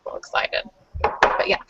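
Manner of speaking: soft breathy whisper. Real, not acted. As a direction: whisper it excitedly. Whispered aside about the food.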